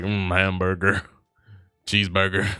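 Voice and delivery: grunted food words with heavy breaths; caricature American. Acted. Streamer showing how Americans talk, grunting caricature. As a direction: grunt each word like a food-obsessed American. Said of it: "Americans talk like".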